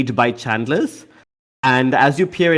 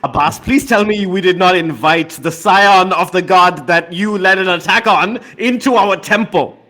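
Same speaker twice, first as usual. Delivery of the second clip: high, raised voice of alarm and reproach; outraged; 7 semitones higher, 5 dB louder than usual. Acted. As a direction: accuse loudly, then plead in exasperation. Padmamukhi outraged at rival's scion present.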